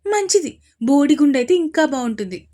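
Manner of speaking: high, loud teasing; mocking. A narrator's reading. Sister teasing her brother about going bald, mocking. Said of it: "వెక్కిరిస్తూ చెప్పింది అవంతిక".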